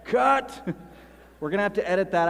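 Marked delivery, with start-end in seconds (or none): 0.0-0.7 loud, high director's shout; sudden mock director's yell; yelled cut. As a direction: yell it like a director stopping the scene. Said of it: "Sometimes he yells".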